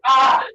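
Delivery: loud, high "ah" outburst; acted frustrated yell. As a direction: let out a mock-enraged cry. Zeus irate, cries out.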